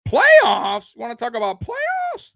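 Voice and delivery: loud, high "Playoffs?" in disbelief; incredulous outburst. Real, not acted. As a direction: blurt it out, can't believe the question. Fan reacts to playoffs question.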